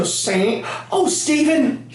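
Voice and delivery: put-on high, quavering old-lady voice, far from his own; old Irish granny. Grandson imitates farting grandma blaming Stephen, high old-lady voice. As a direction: play a dotty grandma blaming someone else. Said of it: "she would just go".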